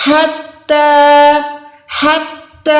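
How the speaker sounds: حتی with the ta stretched a second; حتی, ha from mid-throat, ta drawn out; long ta. Shown as a demonstration. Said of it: "تا کو ایک second لمبا کر کے کھینچیں گے".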